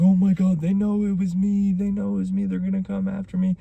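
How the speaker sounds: high, panicky rambling; freaked-out brother. Retold or imitated. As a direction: imitate a paranoid tweaker losing it. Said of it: "tweaking out off drugs, freaking out".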